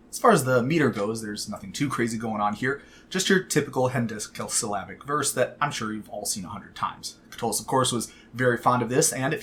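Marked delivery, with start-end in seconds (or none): none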